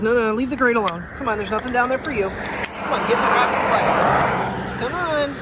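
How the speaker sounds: high, loud call to the dog, pulling her off the grate. Real, not acted. Calling dog away from grate.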